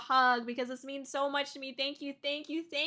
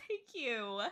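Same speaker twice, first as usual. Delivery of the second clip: usual speaking voice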